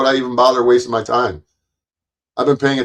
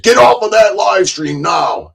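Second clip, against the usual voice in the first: mock handler order; 10 semitones higher, 5 dB louder than usual. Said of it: "he probably got a message and said".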